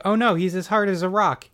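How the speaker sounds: high-pitched, hammed-up "oh no"; mock dismay. Elvira poking the frozen man, mock dismay. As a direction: lift it high in pretend alarm.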